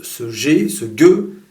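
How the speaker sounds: gueux with a strong, punchy g; forceful g in ce gueux; powerful G. Shown as a demonstration.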